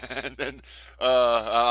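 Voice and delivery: breaking into laughter mid-sentence; laughing. Real, not acted. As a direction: try to carry on through a laugh. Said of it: "I'm sorry I'm laughing".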